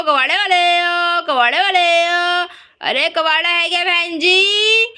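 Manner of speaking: scrap dealer's street cry. Acted. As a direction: call out wares in a repeating singsong. कबाड़ी गली में हाँक लगाता है. (कबाड़ी वाले की लंबी हाँक)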